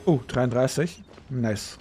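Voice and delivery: ordinary talk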